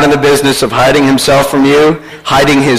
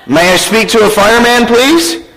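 lightly acted higher-pitched caller; telemarketer; 8 semitones higher than usual. Telemarketer questioning a small boy, lightly acted higher voice. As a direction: coax a small child with a question. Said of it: "A telemarketer".

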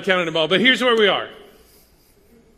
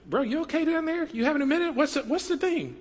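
much higher, softer, gently teasing check-in; casual concerned God; 9 semitones higher, 4 dB quieter than usual. Retold or imitated. God asks sulking Jonah if he's alright, breezy and high. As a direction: play God as a laid-back buddy asking if he's okay. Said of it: "God asks him, like".

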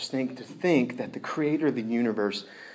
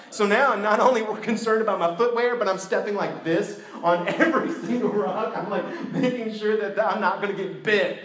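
laughing through the telling; 7 semitones higher, 4 dB louder than usual. Real, not acted. Stepping warily, laughing.

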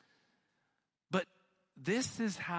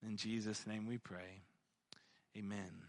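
low, soft prayer voice; subdued, reverent prayer; 4 semitones lower, 7 dB quieter than usual. Real, not acted. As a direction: pray quietly and low, settling softer toward the amen. Leads the closing prayer.

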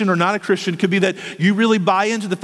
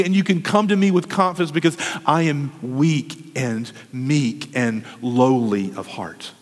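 gentle, hushed; 7 semitones lower than usual. Acted. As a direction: lower into a tender promise. Speaking as gentle Jesus, hushed.

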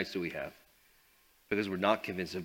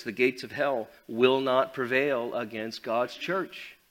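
normal talking voice

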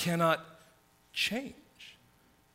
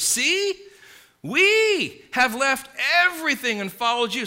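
much higher and louder, eager claim; Peter's boast; 9 semitones higher, 8 dB louder than usual. Retold or imitated. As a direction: blurt it out proudly as Peter. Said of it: "what he has to say is this".

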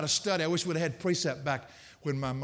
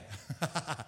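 soft, breathy self-mocking laugh; embarrassed laugh; 8 dB quieter than usual. Real, not acted. Preacher laughing after mangling a Greek word, embarrassed chuckle. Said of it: "I blew that one".

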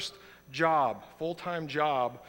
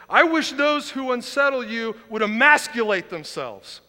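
louder, higher furious quote; angry Paul; 6 semitones higher, 9 dB louder than usual. Acted. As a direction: read Paul's curse with heat. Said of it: "Paul's mad".

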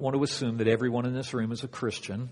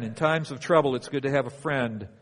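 ordinary talk, nothing marked

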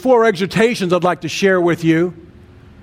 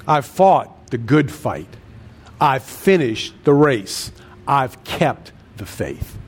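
slow, low, deliberate; 5 semitones lower, slower than usual. Shown as a demonstration. Preacher repeats Paul's words slowly.

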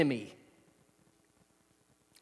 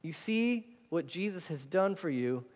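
normal talking voice

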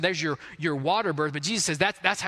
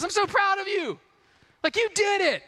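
much higher, bright gushing praise; dad praising the mom right after birth, high and excited; proud dad. Retold or imitated. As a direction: gush over your wife.

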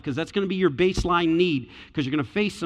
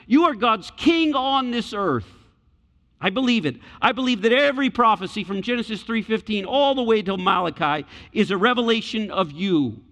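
higher and louder, near shouting; Peter's impassioned creed, almost shouting; fervent confession; 7 semitones higher, 4 dB louder than usual. Retold or imitated. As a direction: cry it out as a passionate vow. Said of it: "That's the emphasis of the text".

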